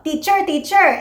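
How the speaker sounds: put-on high Filipino-accented "teacher". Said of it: "Yun naman ay very Filipino".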